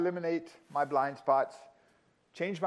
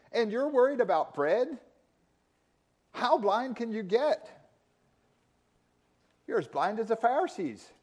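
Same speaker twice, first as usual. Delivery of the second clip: exasperated rebuke; 5 semitones higher than usual. Retold or imitated. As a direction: preach it as Jesus, amazed they miss it. Jesus rebuking disbelieving disciples.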